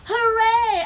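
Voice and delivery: high, loud drawn-out hooray; cheering. A narrator's reading. Cavemen celebrating their enemy's end, cheering. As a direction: cheer it out at the top of your voice. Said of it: "cheered at what they saw".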